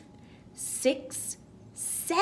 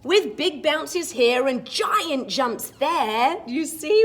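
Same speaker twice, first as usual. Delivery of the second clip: put-on lively read-aloud for children; storybook reading; 4 semitones higher, 9 dB louder, slower than usual. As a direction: read it like a bedtime picture book. Reads the picture book rhyme.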